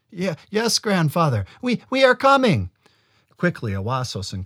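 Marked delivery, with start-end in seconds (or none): 0.1-2.7 high, shaky hurried answer; frightened stammer. A narrator's reading. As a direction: stammer it out in fear. Frightened boy answers giant.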